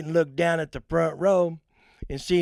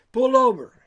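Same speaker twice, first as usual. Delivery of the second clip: raised, louder call to pull over; 10 semitones higher, 8 dB louder than usual. Retold or imitated. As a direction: holler at the car ahead. Calling out to slow drivers.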